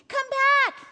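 put-on high, loud child's call; boy calling across a yard. A narrator's reading. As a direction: shout after someone running away. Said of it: "he called".

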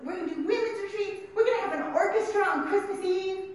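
high, hammed-up promise of fun; mock upbeat announcing. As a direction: pitch it like a cheery event list. Mock upbeat list continues.

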